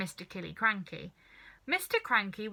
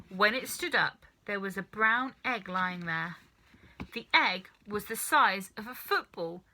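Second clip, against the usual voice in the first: usual speaking voice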